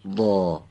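وا drawn out and set slightly back; heavy, backed وا; back-of-mouth sound. Shown as a demonstration.

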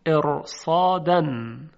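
slow, loud ارصادن with a heavy R; heavy tafkhim R. Shown as a demonstration. Teacher introduces the next example word, heavy R in ارصادن. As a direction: stretch ارصادن, thickening the ر before the ص.

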